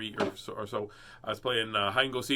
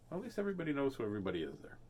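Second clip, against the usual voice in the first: usual speaking voice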